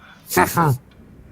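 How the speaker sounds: loud creature cackle; monster cackle. Acted. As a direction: cackle with menace. Creature laughs.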